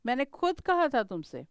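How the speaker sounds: ordinary talk, nothing marked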